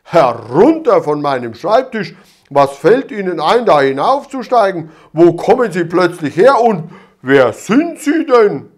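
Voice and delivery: loud, indignant official telling off; angry scolding. A narrator's reading. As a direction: bellow at the intruder on the desk. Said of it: "schimpfte Wachtmeister Dimpfelmoser".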